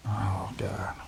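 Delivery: hushed imitation of an overwhelmed friend; dreading whisper. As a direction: breathe it out like a man dreading the send. Imitating Don's dread at sending.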